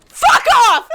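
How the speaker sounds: loud, high burst of swearing; angry shout. Real, not acted. Player swearing at the game, angry shout. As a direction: yell it sharply at the game.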